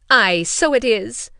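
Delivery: loud, slow exclaiming mother; cried out. A narrator's reading. Mother crying out about the ball, loud and slow. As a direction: call it out with vexation. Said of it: "cried her mother".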